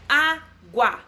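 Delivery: água broken slowly into á-gua; split into syllables. Shown as a demonstration. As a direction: tap out the two beats of água. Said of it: "Dissílaba". (água separada em sílabas)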